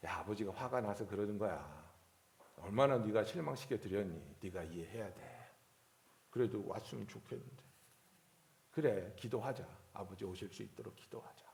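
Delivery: put-on soft, low reassurance to a hurt kid; gentle comforting. Pastor consoling student whose father skips graduation, soft and low. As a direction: soothe him quietly.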